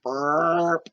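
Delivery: spoken burp sound; mock burp. A narrator's reading. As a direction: answer with a cheeky burp. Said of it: "Marley answered".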